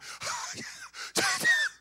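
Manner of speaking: very high squeaky wail; a voice straining to cry out; thin, high wordless squeal; strained cry. Acted. Acts leper straining to shout.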